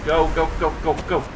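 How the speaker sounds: high, loud frantic yelling; being chased. Real, not acted. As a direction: urge him on, panicked.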